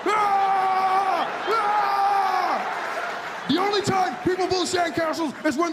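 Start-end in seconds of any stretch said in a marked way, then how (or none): 0.1-3.5 shrill high alarm cry; high panicked shriek. Pretend. America sees a sandcastle, high scream.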